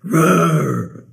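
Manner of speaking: playful bear roar to end the song; bear growl. Pretend. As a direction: give a mock bear roar. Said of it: "that growl at the end".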